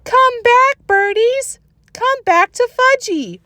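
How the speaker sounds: put-on high, slow little-boy wail; toddler screaming. A narrator's reading. Toddler Fudge calls after the birds, high childish wail. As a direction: call the birds back like a small child. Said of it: "was screaming".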